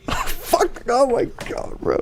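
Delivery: high-pitched laughing disbelief; breathy, laughing swearing. Real, not acted. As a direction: crack up through the words. Reacting while reading character sheet.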